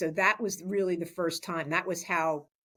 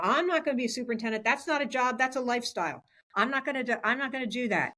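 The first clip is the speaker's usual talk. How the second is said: higher, quick, insistent protest; emphatic refusal; 7 semitones higher, faster than usual. Retold or imitated. Telling colleagues she won't be superintendent, emphatic refusal. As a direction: retell a firm refusal, pitched up and adamant. Retelling her old firm refusal.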